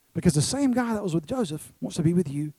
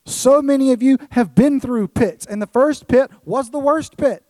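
high, emphatic sermon voice, loud on key words; forceful preaching; 8 semitones higher, 8 dB louder than usual. Real, not acted. Preacher reassuring people who have been through pits, forceful preaching. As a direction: drive each point home to the room.

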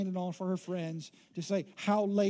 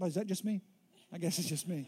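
normal talking voice

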